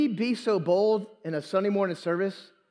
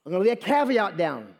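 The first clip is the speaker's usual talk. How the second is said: usual speaking voice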